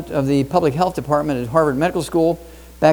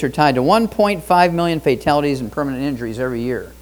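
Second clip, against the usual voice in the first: normal talking voice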